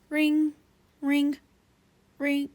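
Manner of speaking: high sing-song ringing; phone ring sound. Acted. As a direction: chime it like a phone.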